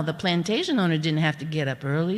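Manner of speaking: ordinary talk, nothing marked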